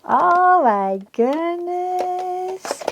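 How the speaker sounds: slow, high gushing; drawn-out delight. Real, not acted. Crafter putting batteries in, slow delighted gush. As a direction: savour the moment, stretching it out. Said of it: "I'm getting way too excited".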